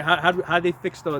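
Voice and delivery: normal talking voice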